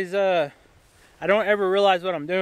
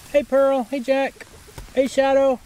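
raised, sing-song call to goats; calling out to animals; 6 semitones higher, 3 dB louder, slower than usual. Real, not acted. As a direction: greet the goats brightly.

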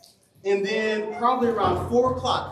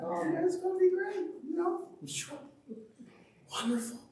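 very high, bubbly and naive; hammed-up naive cheer; mock eager excitement; 12 semitones higher, 7 dB quieter than usual. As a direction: float it up high, clueless and happy. Naive recruit before basic.

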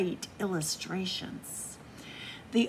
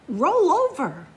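loud command; 7 semitones higher, 8 dB louder than usual. Acted. Reader calling a dog command out loud. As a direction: roll over called out loud, like ordering a dog. Reading picture-book commands.

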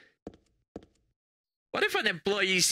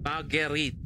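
name said with a French accent. Real, not acted. Player reading out a name, in a French accent. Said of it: "say that with a French accent".

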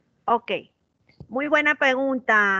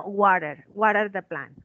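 American accent; 3 semitones lower than usual. Shown as a demonstration. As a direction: flap the T the American way. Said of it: "Si es como americano".

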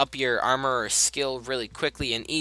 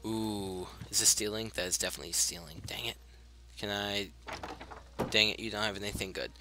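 hushed; 6 semitones lower, 12 dB quieter, faster than usual. Real, not acted. Stealing quietly from shop.

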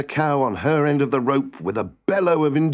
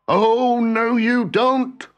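high, drawn-out cry of protest; 10 semitones higher, 4 dB louder, much slower than usual. A narrator's reading. Husband leaps up to stop his wife, crying out. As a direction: leap in to object, pitched way up. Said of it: "cried her husband, leaping out".

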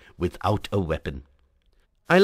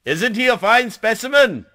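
very high, loud boastful call; exultant shout; 13 semitones higher, 6 dB louder than usual. A narrator's reading. Hunters showing the narrator their dead leopard, shouting proudly. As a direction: call it out high in great good humor.

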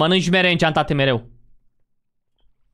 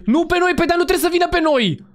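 urgent protest; 9 semitones higher than usual. Real, not acted. Monster comes at the wrong people.

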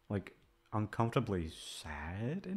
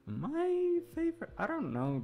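high musing; 5 dB louder than usual. Real, not acted. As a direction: float it up high while thinking.

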